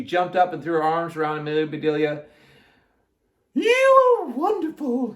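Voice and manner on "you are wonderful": very high, loud, slow gushing voice; Miss Alma. A narrator's reading. Miss Alma hugs Amelia Bedelia, gushing high. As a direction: gush it out way up high. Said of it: "said Miss Alma".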